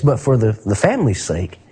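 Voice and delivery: ordinary delivery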